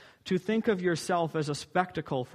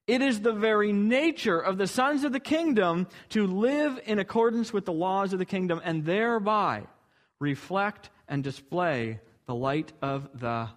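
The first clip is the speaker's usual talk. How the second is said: ordinary talk, nothing marked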